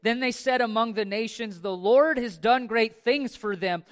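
rousing sermon voice; fervent preaching. Acted. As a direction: preach it out full force to the congregation. Preaching at full force.

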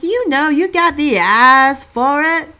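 very loud, high cashier impression; exaggerated high-pitched shouted retort; imitated cashier. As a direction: belt it out as the brash cashier. Imitates cashier's blunt stereotype.

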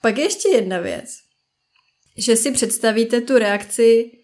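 ordinary talk, nothing marked

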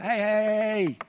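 loud, high, drawn-out hey; calling out. Acted. Acting out God calling to someone.

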